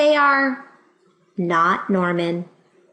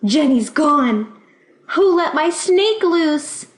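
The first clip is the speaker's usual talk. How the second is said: loud, high panicked shout; a girl shouting that her snake is loose; 6 semitones higher, 4 dB louder, slower than usual. Acted. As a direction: yell it across the classroom in alarm. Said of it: "Emily shouts".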